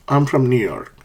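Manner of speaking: "I'm from New York." dropping on "New York"; plain fact, voice stepping down to "York"; falling intonation. Shown as a demonstration. Teacher showing the tune of statements, falling intonation. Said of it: "we use a falling intonation".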